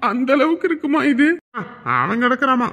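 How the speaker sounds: usual speaking voice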